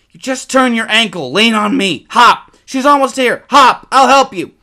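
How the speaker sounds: put-on high, loud, urgent boy's cry; panicked shout. A narrator's reading. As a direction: yell it with a bear coming. Boy urges limping brother on.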